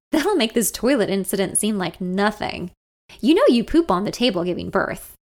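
usual speaking voice

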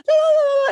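very high mock freak-out wail; panicked "ah". Teacher phoning sister-in-law, freaking out. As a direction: let out a high, acted panic cry. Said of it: "and I'm freaking out".

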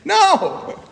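high-pitched mock protest; hammed-up yell, played for laughs; imitated yell. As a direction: squeal it like a protesting girl. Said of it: "she started yelling at me again".

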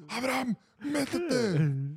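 orden aguda, con vocales muy estiradas; llama al relevo gritando, como el coach; grito del coach. Retold or imitated. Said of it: "me empieza a gritar". (imitación de orden gritada en el campo)

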